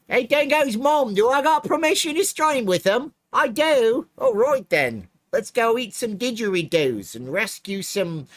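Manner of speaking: very high, loud, drawn-out fake Aussie; badly faked Australian accent that wanders off; muddled Australian accent. Asking Dingo's mom's permission to stream, muddled Australian accent. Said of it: "I don't even know what accent that even is".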